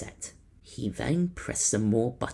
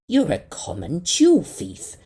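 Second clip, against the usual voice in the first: accusing; 7 semitones higher, 10 dB louder than usual. A narrator's reading. Captain accuses creature.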